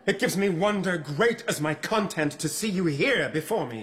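high-pitched verse declamation; Othello's stage speech. Acted. Othello speaks love to his bride, declaimed high. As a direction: declaim the verse in one sustained high line.

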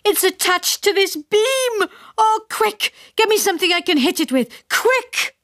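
high, frantic cries; panicked father. A narrator's reading. Father begs the family for a tool: frantic, high. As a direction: yell for help in a panic.

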